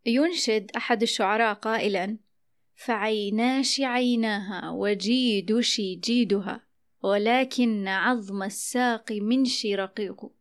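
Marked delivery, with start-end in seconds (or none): 2.8-10.3 slow recitation, فعيناش وجيدش منش with sh for k; kashkasha verse. Shown as a demonstration. Host recites a poet's line, kashkasha dialect. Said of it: "يبدل المتحدث الشين من كاف".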